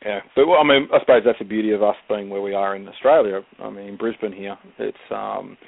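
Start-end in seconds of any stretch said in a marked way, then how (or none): none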